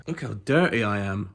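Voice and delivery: ordinary talk, nothing marked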